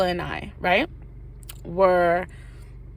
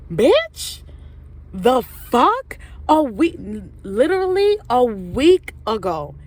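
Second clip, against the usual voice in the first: much higher and louder outraged cry; outraged exclamation; 4 semitones higher, 4 dB louder, slower than usual. Retold or imitated. Teller reacting to Taylor dating T's boyfriend, outraged cry. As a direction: let the betrayal explode out.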